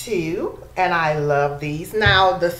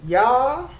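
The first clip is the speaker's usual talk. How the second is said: higher, louder rising y'all; excited call-out; 8 semitones higher, 3 dB louder than usual. Real, not acted. Excited about [name] perfume.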